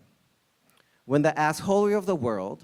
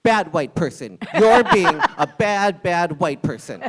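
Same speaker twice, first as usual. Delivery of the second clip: louder, laughing scold like training a pet; mock scolding; 3 semitones higher, 8 dB louder than usual. Diner tapping the customer's knee, laughing mock scold. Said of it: "something less aggressive, like tapping him on the knee".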